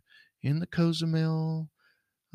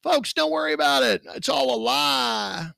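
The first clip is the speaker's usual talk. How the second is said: loud, high, mocking reassurance; sarcastic mock shout; 7 semitones higher, 3 dB louder than usual. Host mocking the official line to listeners, sarcastic shout. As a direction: shout it like a fake cover-up.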